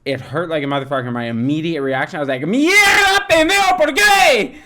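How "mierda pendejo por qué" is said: loud, high Spanish cursing; yelled Spanish curse. Retold or imitated. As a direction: shout in pain at your toe. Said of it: "I yelled that".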